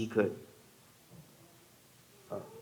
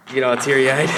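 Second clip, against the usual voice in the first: after crying; 2 semitones higher, 6 dB louder than usual. Real, not acted. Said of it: "sorry, I'm trying not to".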